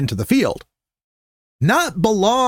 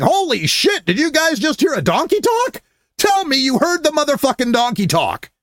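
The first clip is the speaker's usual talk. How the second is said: mock screaming; 9 semitones higher than usual.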